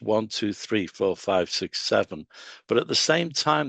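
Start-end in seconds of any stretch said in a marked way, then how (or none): none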